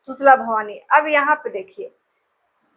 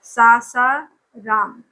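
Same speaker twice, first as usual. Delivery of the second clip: slow dictation; 3 semitones lower, much slower than usual. Shown as a demonstration. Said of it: "तो ये है आपका सासाराम".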